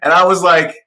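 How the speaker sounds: usual speaking voice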